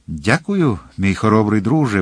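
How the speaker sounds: plain reading voice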